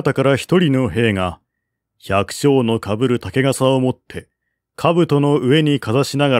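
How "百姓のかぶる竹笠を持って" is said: ordinary delivery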